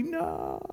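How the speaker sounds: slow-motion no. Acted. Parent's slow-motion cry.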